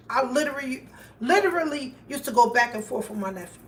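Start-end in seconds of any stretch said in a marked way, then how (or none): none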